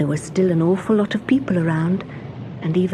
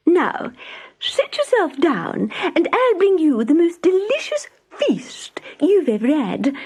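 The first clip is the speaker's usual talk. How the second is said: hosting mouse; 10 semitones higher than usual. Acted. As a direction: welcome your guest grandly.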